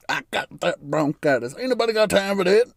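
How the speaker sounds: comic viral-video imitation; meme impression. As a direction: rattle off the catchphrase, pitch climbing.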